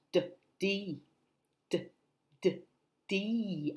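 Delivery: normal talking voice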